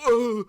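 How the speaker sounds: sharp high, loud gasp of shock; sudden gasping yelp; startled gasp. Retold or imitated. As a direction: re-enact a jump-scare reaction. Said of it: "I was like".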